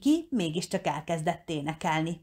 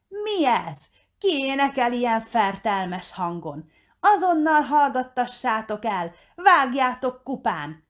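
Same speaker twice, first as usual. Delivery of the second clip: higher, louder villain's fury; outraged outburst; 5 semitones higher, 3 dB louder than usual. A narrator's reading. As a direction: roar orders as the outraged villain. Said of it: "hördült fel". (felháborodottan felhördülve)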